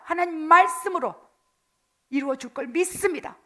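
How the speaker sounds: loud, slow fervent proclamation; preaching at full force. Acted. Preacher stirring the congregation to faith, fervent loud preaching. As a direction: declare it like a rallying cry.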